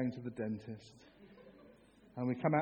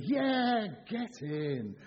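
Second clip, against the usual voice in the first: high, drawn-out "yeah"; football-style cheer; 4 semitones higher, much slower than usual. Acted. Angels' basic message about the birth, excited cheer. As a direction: celebrate like a fan, then ease off. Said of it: "But, but it's basically".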